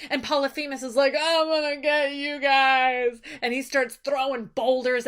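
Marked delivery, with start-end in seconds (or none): 1.2-3.2 slow, menacing giant threat. Retold or imitated. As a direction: growl it out slowly like a vengeful giant. Giant threatens fleeing ship.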